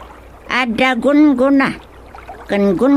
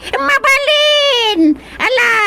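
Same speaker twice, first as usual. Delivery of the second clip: eager yell; 13 semitones higher than usual. Acted. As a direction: shout it out with glee. Creature answers eagerly.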